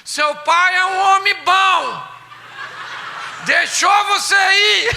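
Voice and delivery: loud high, slow calling out; loud call down hall. Retold or imitated. Wife calling her daughter so the husband hears, loud. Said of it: "ela falava alto pra ele escutar".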